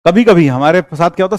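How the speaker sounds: ordinary talk, nothing marked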